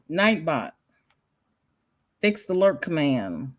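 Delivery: ordinary talk, nothing marked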